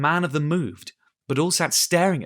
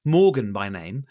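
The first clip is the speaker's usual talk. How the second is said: plain reading voice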